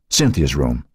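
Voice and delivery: usual speaking voice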